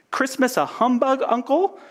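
high, disbelieving question; incredulous. Acted. Nephew questioning Scrooge's humbug, incredulous. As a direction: pitch it way up in protest.

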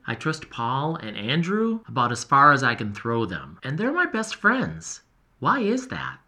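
ordinary delivery

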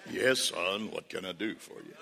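very low, soft voice of God; James Earl Jones. Acted. God answering his son, deep movie-star impression. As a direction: rumble down low like a fatherly God. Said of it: "sometimes it sounds like James Earl Jones".

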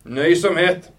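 high, loud proclamation; one loud, oratorical exclamation; declaiming. A narrator's reading. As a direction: declaim it to the assembly. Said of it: "deklamerte han til forsamlingen".

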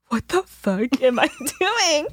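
slow, high, amused bewilderment; high, laughing disbelief; bewildered disbelief. Retold or imitated. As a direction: draw it out in mock horror at yourself. Retold bewildered realisation after gasp.

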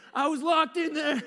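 high, loud and laughing; telling the uncle, half laughing; laughing exclaim. Retold or imitated. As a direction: blurt it out through laughter.

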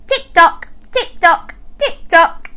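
high, crisp, regular beats; ticking clock. Pretend. Presenter revealing the clock sound, high regular ticking. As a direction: tick evenly like a clock. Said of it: "It was a clock".

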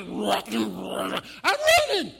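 loud, drawn-out snake roar; monster snake talk. Acted. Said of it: "than snakes talking".

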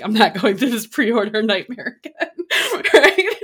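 half laughing, high and loud, fed up; laughing through it. Real, not acted. To listeners about the pre-order mess, laughing loudly. Laughing at her own frustration.

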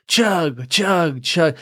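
rowdy frat chant; frat bro. Retold or imitated. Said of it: "a frat party of guys going".

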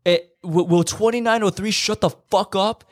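high, mocking squeaky yell; mock angry neighbour. Retelling a chat fight, mocking angry neighbour. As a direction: mimic a petty neighbour snapping.

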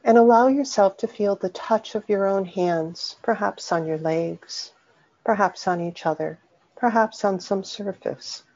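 unhurried meditation voice with long pauses; guided meditation. Acted. Teacher leading listeners through a soothing body scan, guided meditation. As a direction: guide them gently inward, phrase by phrase, leaving long silences.

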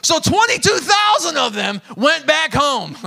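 loud, high, slow exclamation; preaching shout. Real, not acted. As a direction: hammer the number home to the congregation.

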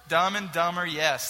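ordinary talk

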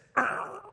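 high-pitched mimicked noise of a hit cat; imitated roadkill noise. Father-in-law showing how the cat looked, high imitated noise. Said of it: "It looked like this".

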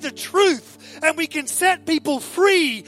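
impassioned, high-pitched preacher's outcry; frustrated, fervent declaration; fed up. Acted. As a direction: cry out that you've had enough. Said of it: "someone who was fed up".

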